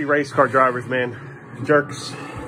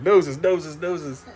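imitating his kid; 5 semitones higher, much slower than usual. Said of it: "He's like".

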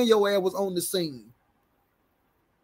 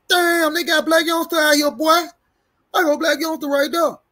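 high, loud retold exclamation; imitating excited bystander; 9 semitones higher, 5 dB louder than usual. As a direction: mimic someone excitedly pointing out a celebrity. Retells bystander spotting a celebrity.

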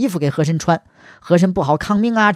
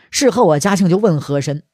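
ordinary delivery

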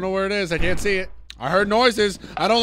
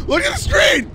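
higher, urgent shout; 8 semitones higher than usual. Real, not acted. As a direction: yell it to make them look. Repeated, second shouted.

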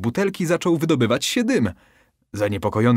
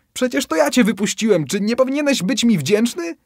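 high, louder indignant plea; pleading; 12 semitones higher, 4 dB louder than usual. Acted. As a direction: remind him you freed him, pleading. Fisherman keeps pleading.

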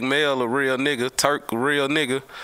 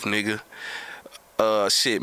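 ordinary talk

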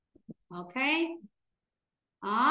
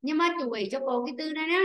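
ordinary talk

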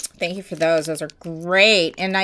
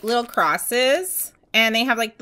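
usual speaking voice

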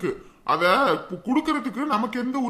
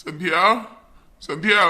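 louder call across the house; raised rising call for the daughter; 3 semitones lower, 6 dB louder than usual. Acted. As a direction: call the daughter from another room. Father calls daughter from afar.

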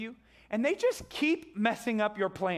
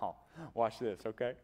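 cocky; 11 semitones lower, 7 dB quieter than usual. Acted. Elisha unbothered by enemy army.

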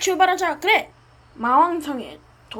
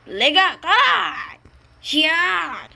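loud, slow battle cry; 4 dB louder, much slower than usual. Acted. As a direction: call out the attack slowly. Hero launches the attack.

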